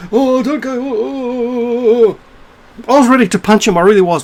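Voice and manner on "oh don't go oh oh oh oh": high put-on wail, drawn-out oohs; mock pleading. Mimicking the cafe man as he walks away, mock pleading. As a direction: imitate a pathetic, wailing plea.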